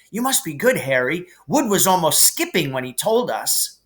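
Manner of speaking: eager twin boasting about the team; lightly voiced eager twin. Twins tell Harry about the Quidditch team, eager and proud.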